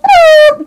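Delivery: high, loud shriek of laughter; laugh-scream as the ride drops; thrilled squeal. Retold or imitated.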